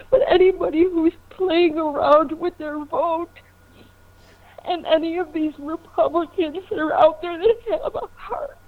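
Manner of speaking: begging through tears; crying plea. Real, not acted. Caller pleading, crying about child.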